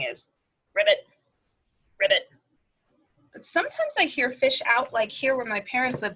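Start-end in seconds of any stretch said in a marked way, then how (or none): 0.7-2.3 frog ribbit. Retold or imitated. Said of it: "what we say, just kind of as a generic thing".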